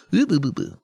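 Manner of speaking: quick nonsense "buh-buh" noises; mock babbling. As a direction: babble like a garbled word. Mocks own babbled mispronunciation.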